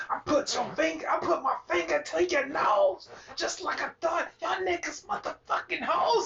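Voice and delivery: high-pitched, hammed-up rap delivery; emphatic rapper impression. Quoting a song lyric to his friend, emphatic high impression. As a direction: imitate a rapper hitting every word hard. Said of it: "You said it with some emphasis".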